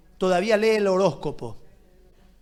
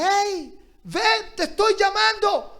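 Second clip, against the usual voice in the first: higher, urgent call of God to come back; God calling again; 10 semitones higher, slower than usual. Acted. God calls the distant listener back, urgent and high. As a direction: call out warmly but with urgency, pitched high. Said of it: "Dios te dice".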